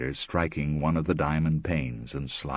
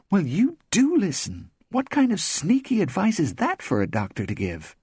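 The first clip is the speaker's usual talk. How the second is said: higher and louder, outraged friend; indignant Tiger; 4 dB louder than usual. Acted. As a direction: scoff at the sneaky advice.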